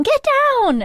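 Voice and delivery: high, loud warning shout. Acted. Winter diving for Penelope as the shot comes, shouting. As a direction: yell it as an urgent warning.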